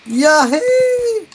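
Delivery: excited whoop. Real, not acted. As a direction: whoop it out with glee. Jumping into deep cavern.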